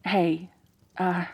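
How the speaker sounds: usual speaking voice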